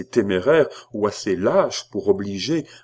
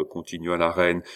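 usual speaking voice

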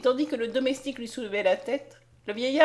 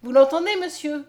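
raised, eager exclamation; crying out; 5 semitones higher, 7 dB louder than usual. A narrator's reading. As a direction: appeal loudly to the doctor as proof. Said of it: "s'écria la jeune fille". (s'écrier vivement, voix haute)

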